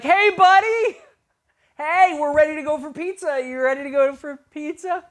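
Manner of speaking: loud, very high mock-casual greeting; hammed-up high chirp to cover the panic; over-cheerful greeting. Leader greeting found student, acted over-cheerful shout.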